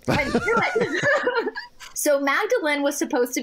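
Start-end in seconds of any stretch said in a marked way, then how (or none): 0.1-1.6 high, drawn-out and breaking into laughter; laughing triumph. Retold or imitated. Woman vindicating her eight-year-old self, laughing triumphantly. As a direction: crow it slowly through a laugh.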